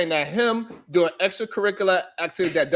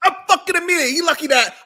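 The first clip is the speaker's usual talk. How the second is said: higher, louder angry rant; outraged; 8 semitones higher, 4 dB louder, faster than usual. Real, not acted. Reacting to the superintendent's excuse, angry and raised.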